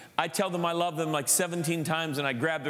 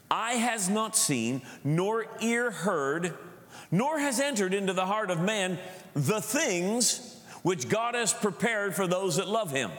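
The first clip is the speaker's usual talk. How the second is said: slow, raised declaiming voice; solemn scripture reading; 3 semitones higher than usual. Real, not acted. Preacher reads First Corinthians to the church, slow declaiming. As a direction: read it out deliberately, phrase by phrase.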